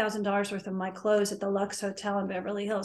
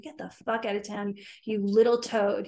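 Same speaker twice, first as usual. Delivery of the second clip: normal talking voice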